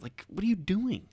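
high disbelieving question; incredulous. Real, not acted. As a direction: ask it in disbelief. Incredulous rhetorical.

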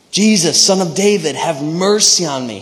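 loud, high cry for mercy; acted screaming. Blind man screams after Jesus for attention, loud acted cry. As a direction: shout it out, then ease off by the end. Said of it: "He was screaming so loud".

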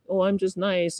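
high, innocent sweet voice; put-on niceness. Activist instead of going crazy, put-on niceness. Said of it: "instead of going crazy, you're like".

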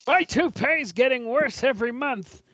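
high, loping mock voice for Larry; put-on high character whine; Larry impression. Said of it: "here's Larry and his rug again".

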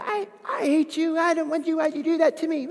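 put-on high, childish tantrum voice; angry little kid. A small child yelling at mom and dad, high and petulant. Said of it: "What does a little kid do when they're angry".

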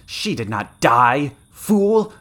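slow, loud rebuke, rising to a cry; loud, scornful villainess; snarling contempt. A narrator's reading. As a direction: spit the insult high and hard. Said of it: "spat from between the snarling red lips".

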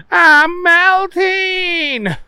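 very slow, high, loud wail; dramatic yell. Acted. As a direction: wail it out melodramatically. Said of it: "Booger yelled out".

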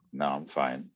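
low, soft brush-off; subdued retold denial. Man retelling his answer to her "does not feel good", subdued.

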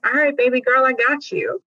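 put-on lightly sassy, gangster-style dad comforting his girl; sassy Lord. God as dad reassuring his baby girl, sassy. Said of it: "The Lord has some sass".

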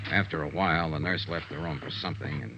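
dry, measured private-eye narration; steady noir voice-over; hard-boiled narration. A narrator's reading. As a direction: tell it like a tired detective's report. Detective narrates his report.

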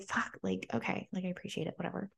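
quiet, grudging mock thanks; muttered resigned aside. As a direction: mumble it, half-annoyed, half-giving-in. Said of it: "And I was like".

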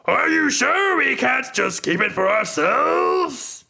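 high, slow, sly character voice; Ront's sly voice. Acted. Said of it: "Ront laughs at them both".

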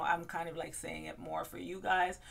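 ordinary delivery

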